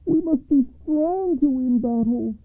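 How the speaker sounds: high-pitched call over a wall; son crying out. A narrator's reading. Oldest son pleading to his mother, high calling voice. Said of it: "cried the oldest son over the east wall".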